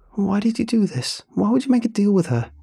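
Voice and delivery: upset demand. A narrator's reading. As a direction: cry it out, hurt and frantic. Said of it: "she asked".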